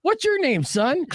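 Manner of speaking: high, corrected mock-fatherly question; patronizing dad. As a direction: ask him like a little boy. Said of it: "Oh, I go".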